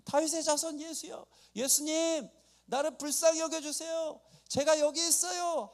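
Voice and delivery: high, urgent calling out; acted desperate shouting. Blind man begs Jesus to notice him, high acted shout. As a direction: cry for attention as the blind beggar. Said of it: "하고 소리를 지니까".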